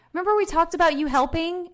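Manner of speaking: accusing nag; nagging reminder. Shown as a demonstration. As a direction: make it sound like a reproach. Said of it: "versus this".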